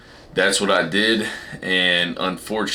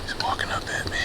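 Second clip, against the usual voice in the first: whispering; 8 semitones higher, 10 dB quieter than usual. Real, not acted. Whispering as second buck approaches.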